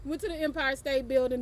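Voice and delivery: normal talking voice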